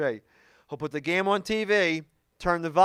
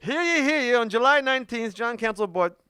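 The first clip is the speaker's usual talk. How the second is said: high, proclaiming town crier call; comic public proclamation, starting high and falling; 6 semitones higher than usual. Acted. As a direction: announce it like a mock town crier. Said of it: "like, town criers".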